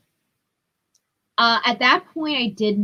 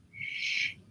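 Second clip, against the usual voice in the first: breathed-out shit under the breath; whispered curse; 13 dB quieter than usual. Retold or imitated. Realizing something is wrong on the call, a whispered curse. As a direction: whisper it, caught off guard. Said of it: "And I remember going".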